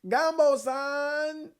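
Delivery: "Gumbo san!" high and slow, still off; high drawn-out call. Shown as a demonstration. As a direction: pull "Gumbo san!" long at a high pitch. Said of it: "I can't get the right pitch".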